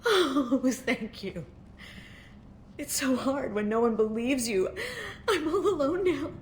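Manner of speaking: sobbing through broken laughter; tearful, voice breaking high; crying. Acted. Grieving mother thanks stranger.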